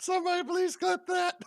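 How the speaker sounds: excited. Real, not acted. Excited laughing request.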